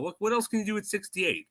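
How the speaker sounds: ordinary delivery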